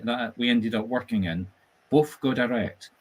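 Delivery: ordinary talk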